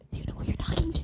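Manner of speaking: hushed, pointed challenge; sassy whisper. Retold or imitated. Firing back at the flexing guy in a pointed whisper.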